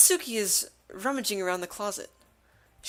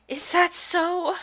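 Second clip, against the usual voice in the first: high, slow, hesitant question; shy game character; 9 semitones higher, 4 dB louder, much slower than usual. Acted. Voicing a shy girl in a game, hesitant. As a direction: draw it out shyly, pitched high.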